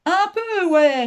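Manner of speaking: loud, high, crude jeering reply; vulgar sneering. A narrator's reading. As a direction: answer with a coarse, high snigger in the voice. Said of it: "avec un ricanement d'une extrême vulgarité".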